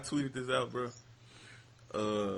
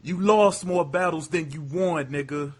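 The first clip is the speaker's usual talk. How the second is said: loud, higher-pitched battle-rap delivery; 4 semitones higher, 8 dB louder than usual. Retold or imitated. Showing how a battle rapper said it, loud imitation. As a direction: hurl the punchline at your opponent. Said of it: "This is exactly how he said it".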